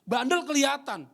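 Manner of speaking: high, loud pulpit preaching; preaching at full force. Acted. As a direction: drive it home to the congregation. (khotbah berapi-api, tinggi dan keras)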